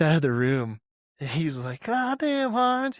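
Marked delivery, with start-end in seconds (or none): 1.8-3.0 raised, high-pitched quote of a sergeant; retold sergeant's exclamation.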